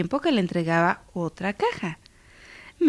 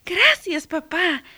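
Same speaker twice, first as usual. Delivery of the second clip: put-on high, bright-pitched child's thanks; grateful girl; 10 semitones higher, 4 dB louder, slower than usual. A narrator's reading. Girl receives a gift from father, high grateful voice. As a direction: thank him in a much higher girl's voice. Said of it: "dijo ella".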